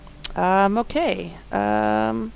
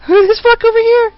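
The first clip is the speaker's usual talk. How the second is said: much higher, louder laughing yelp; startled shout; 11 dB louder than usual. Real, not acted. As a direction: shriek in amused surprise. Startled by game character.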